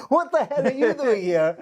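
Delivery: high, retold astonished greeting; mock disbelief at bumping into a friend; surprised. Old friend turning round in the theater, astonished. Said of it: "she was like".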